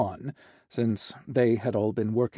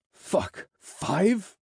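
hissed disbelief, jumping high on the question; dismayed hiss; 8 semitones higher than usual. A narrator's reading. Boss hearing of five meetings, hissing in dismay. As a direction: hiss it, then pitch up incredulously. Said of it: "I hissed".

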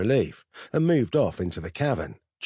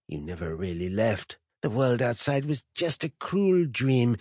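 malign tempter; 2 semitones higher than usual. Acted. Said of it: "his gaze full of a malign intensity".

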